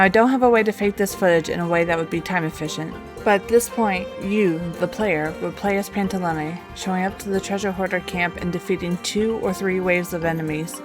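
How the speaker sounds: usual speaking voice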